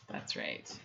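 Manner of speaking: breathy whisper, no voice. Real, not acted. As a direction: whisper it to yourself. Reads chat line under breath.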